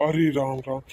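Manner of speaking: ordinary talk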